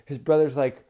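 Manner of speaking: plain reading voice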